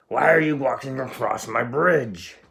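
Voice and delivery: loud yelling troll. Acted. Troll yelling at the goat crossing his bridge. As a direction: yell it at the goat like a hideous troll. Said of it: "yelled the hideous".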